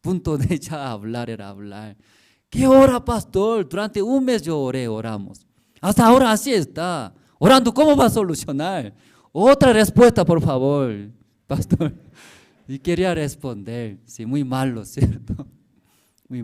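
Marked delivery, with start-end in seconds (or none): 2.5-11.9 loud, higher retold protest at the pastor; fed up, demanding another answer, voiced loud and high; exasperated complaint. Andrés complaining to his pastor that prayer didn't work, loud and exasperated.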